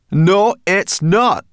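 slow, loud, high retort; contradicting. A narrator's reading. Sorrel brushing off Ben's objection, contradicting. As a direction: dig your heels in loudly.

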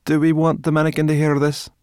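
high, loud, suspicious question; interrupting objection. A narrator's reading. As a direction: object pointedly in a brownie's voice. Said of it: "Sorrel interrupted".

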